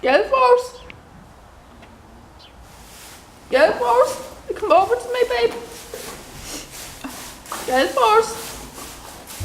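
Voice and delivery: high, drawn-out name call. Real, not acted. Asking the horse to come over, high calling out.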